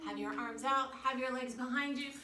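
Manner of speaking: normal talking voice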